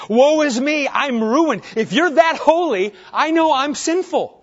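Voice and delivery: a prophet crying out he is undone; loud anguished woe; Isaiah's dread. Retold or imitated. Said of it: "what's Isaiah say".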